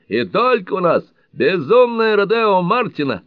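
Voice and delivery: high, loud fairground barker shouting; carnival barker. A narrator's reading. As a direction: hawk the ride like a showman. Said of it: "балаганный зазывал и принялся энергично кричать".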